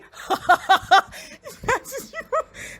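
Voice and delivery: high rising disbelieving laugh; shocked high laugh. Real, not acted. Watching the show, a high shocked laugh.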